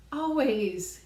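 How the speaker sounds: loud, high Always again falling; emphatic repeat. Real, not acted. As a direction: hammer the word Always home.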